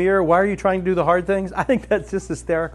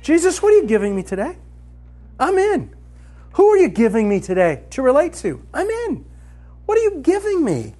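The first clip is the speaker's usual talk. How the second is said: eager; 7 semitones higher than usual. Shown as a demonstration. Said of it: "Here's how you wake up every morning".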